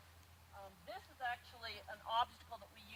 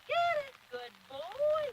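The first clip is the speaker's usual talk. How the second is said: high, loud excited praise; excited release; 10 dB louder, much slower than usual. Real, not acted. Trainer rewarding Wiggins on the far side, loud excited praise. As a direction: cheer the dog on to his treat.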